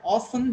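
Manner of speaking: "often" as OFF-un; British silent T. Shown as a demonstration. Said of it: "mostly we are following British English".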